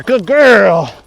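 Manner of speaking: excited dog praise. Real, not acted.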